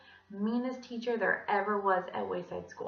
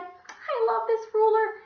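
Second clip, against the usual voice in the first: high, slow and loud; overwrought gushing; 13 semitones higher, 8 dB louder, slower than usual. A narrator's reading. Teacher gushing over a student's ruler, high and slow.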